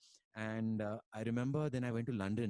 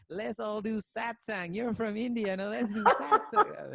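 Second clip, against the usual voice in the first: much higher and slow, imitating the teacher's push; eager teacher; 11 semitones higher, 7 dB louder, slower than usual. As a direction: drag out a high, insistent call to satsang. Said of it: "He says".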